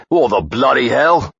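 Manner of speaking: high, loud English shout of shock; a startled Englishman bellows, slowly; shouted in thick English accent. A narrator's reading. Guest on the bed yells at armed intruders, loud thick English accent. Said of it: "shouted the man in a thick English accent".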